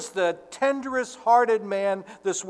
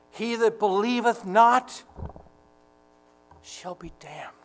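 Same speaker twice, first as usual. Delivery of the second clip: halting, grief-struck, sinking to a whisper; heartbroken; 3 semitones higher than usual. Retold or imitated. Preacher voicing tender-hearted Jesus weeping over the lost, heartbroken. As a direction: say it as though it breaks your heart, long pause, hushed ending. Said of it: "As though it broke his heart".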